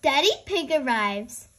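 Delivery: usual speaking voice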